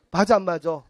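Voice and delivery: normal talking voice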